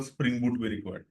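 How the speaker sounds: ordinary delivery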